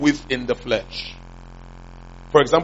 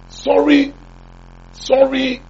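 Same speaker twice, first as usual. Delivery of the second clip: high, mock American "sorry, sorry" as the angry wife; imitating his wife's American accent when she's angry; 11 semitones higher, slower than usual. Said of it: "she speaks American when she's angry".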